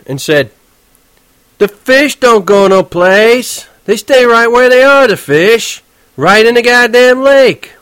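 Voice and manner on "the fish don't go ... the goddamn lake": slow, higher, louder New York cabbie; touchy cabbie. Acted. Horwitz explaining fish to Holden, irritated. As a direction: lecture the kid, exasperated.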